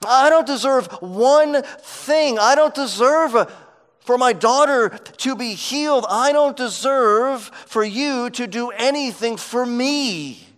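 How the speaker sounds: a mother crying she deserves nothing; humble pleading. Retold or imitated. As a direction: voice her pleading with rising intensity, stressing don't. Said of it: "what she is saying is".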